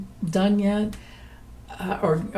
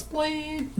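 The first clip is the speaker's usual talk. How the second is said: high, soft imitated TV bleep; mock censor bleep; 8 semitones higher, 8 dB quieter than usual. Imitating the TV censor, a high soft bleep. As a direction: chirp it like a censor tone. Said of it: "on T V it goes".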